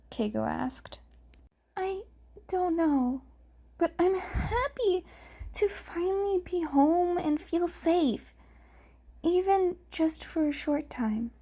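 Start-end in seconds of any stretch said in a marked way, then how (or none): 1.8-11.3 put-on a shy boy glad to be safe; a hint of a younger, higher voice; character voice. A narrator's reading. Izuku voiced in dialogue.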